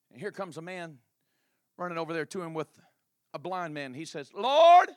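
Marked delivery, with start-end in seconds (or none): 4.3-4.9 loud, high call. Acted. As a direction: call out across to him. Man calling out to Jesus.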